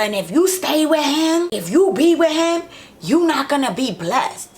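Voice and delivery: high-pitched preachy imitation of his mother; retold mother telling her she won't be blessed; imitating the mother. Mother warning her off Bob.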